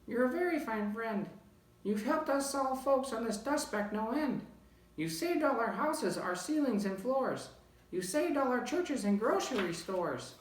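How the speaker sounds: very high, thin grateful voice; squeaky little mayor; tiny Who voice. A narrator's reading. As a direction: call up from a speck, thankful. Tiny voice of the speck.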